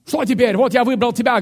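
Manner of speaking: high, loud acted plea; demanding complaint. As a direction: call out to God impatiently, in character. Acting a complaining believer.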